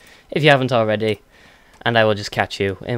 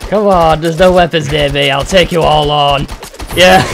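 challenging everyone at full voice; loud taunt; 4 semitones higher, 7 dB louder than usual. Real, not acted. Player loudly taunting the other players, come on. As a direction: bring it on, shouting to all comers.

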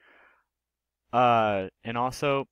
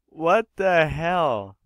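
shocked; 6 semitones higher, 7 dB louder, much slower than usual. Real, not acted. Shocked by the video.

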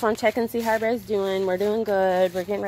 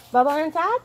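raised, rising call to the cat; coaxing the cat; 6 semitones higher than usual. Real, not acted. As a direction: ask the cat sweetly with a rise. Coaxing cat to come inside.

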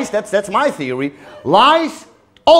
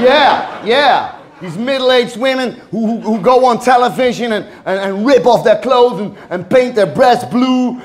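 usual speaking voice

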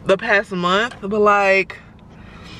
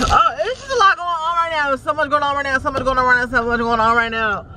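overwhelmed sigh; 5 semitones higher than usual. Real, not acted. Overwhelmed while driving in traffic.

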